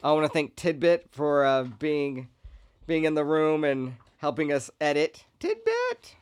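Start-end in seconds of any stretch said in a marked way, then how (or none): 5.4-6.2 high-pitched call to a pet; sing-song call of a name. Real, not acted. Calling out to the dog.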